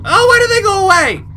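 high loud wail of dismay; dismayed cry. Real, not acted. Gamer protests at what happened on screen, dismayed cry.

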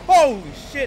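loud, high exclamation; startled yell. Real, not acted. Player startled by a scare, exclaiming loudly. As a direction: blurt it out in shock.

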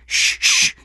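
unvoiced hushing shush; whispered shush. A narrator's reading. Detective hushing his partner, whispered. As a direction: hush him while still typing. Carella hushing Meyer while typing.

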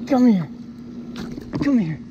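high, loud shouts at the fish; urgent calling. Real, not acted. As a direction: call it in hard, straining. Straining to land heavy fish.

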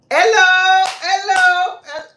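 loud, high hello to a newcomer; calling out hello. Real, not acted. Greeting a viewer who just joined, loud and high. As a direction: sing out a greeting over the chat. Greets someone joining the live stream.

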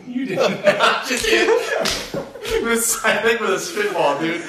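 high, loud incredulous outburst with laughter; mock disbelief. Classmate seeing the teacher's hit hand, laughing disbelief. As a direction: act scandalized, breaking into laughs. Acts out own shocked reaction.

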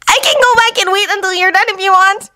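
put-on high-pitched, obliging young ninja; helpful Naruto. A narrator's reading. Naruto offers Sasuke another try, high obliging voice. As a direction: offer it in a high, eager-to-please voice. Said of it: "Naruto apologized".

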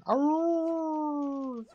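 high drawn-out howl; wolf voice. Retold or imitated. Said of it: "the eight o'clock howl in my neighborhood".

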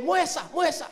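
high, urgent mock call for attention; chamando alto. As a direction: call out to the airline clerk in fake Spanish. Chama a funcionária da companhia. (chamando alto, afobado)

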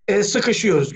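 normal talking voice

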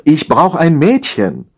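slow, high-pitched calling; drawn-out wifely shout into the distance; calling after him. A narrator's reading. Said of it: "rief sie ihm nach".

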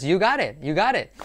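"you got it" flowing as "yu-GA-ret"; linked spoken form. Shown as a demonstration. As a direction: model "you got it" as connected speech. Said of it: "Sí, eso es".

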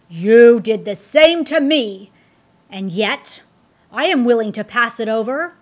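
loud accusing demon, rushing the end; forceful Apollyon. Acted. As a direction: throw his betrayal back at him.

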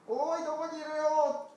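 high, loud calling across the hills. Acted. Shepherd calling loudly for the lost lamb. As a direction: shout out to find the lost lamb.

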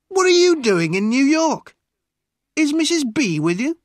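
loud, high-pitched questioning; loud cry. A narrator's reading. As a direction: call out the questions at high volume. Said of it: "he cried loudly".

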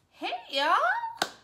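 very high, loud squeal; squealed greeting. Pretend. Vlogger squealing hello to her viewers, very high and loud.

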